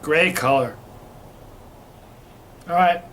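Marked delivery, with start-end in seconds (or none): none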